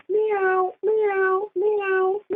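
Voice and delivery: high-pitched imitation meowing; playful cat sound for a memory game. Shown as a demonstration. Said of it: "it's a cat".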